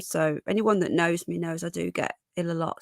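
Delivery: ordinary talk, nothing marked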